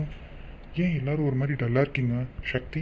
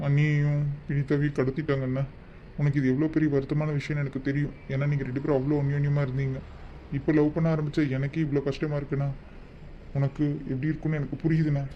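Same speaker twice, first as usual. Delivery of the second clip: normal talking voice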